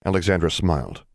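ordinary delivery

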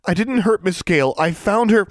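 high, loud, panicked denial; urgent protest. A narrator's reading. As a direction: blurt it out in self-defence, voice pitched high. Accused girl protests her innocence.

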